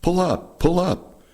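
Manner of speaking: high, panicked retold scream. Bombardier in the nose screaming at the pilot, high panicked yell. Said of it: "the bombardier up in the nose was screaming".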